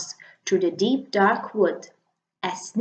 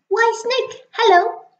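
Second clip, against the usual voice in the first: much higher mouse, bright and quick; little mouse voice; 13 semitones higher, 5 dB louder than usual. Acted. The mouse announcing Snake, high little voice. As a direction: pitch it up like a tiny mouse.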